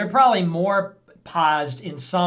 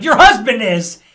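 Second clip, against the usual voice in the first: loud, higher mock snap back; mock retort; 6 semitones higher, 12 dB louder than usual. Streamer acting a debate comeback, loud. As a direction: fire the retort back hard. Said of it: "Bill Clinton was like".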